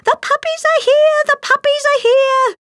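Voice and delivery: very high, loud happy shout; excited shouting. A narrator's reading. Housekeeper announces the puppies, loud and very high. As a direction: call out the joyful news. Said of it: "Nanny was shouting".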